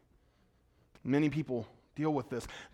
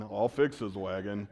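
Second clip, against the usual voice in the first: John Wayne impression; 10 semitones lower, 5 dB quieter than usual. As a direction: growl it like an old Western hero. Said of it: "I sounded more like John Wayne".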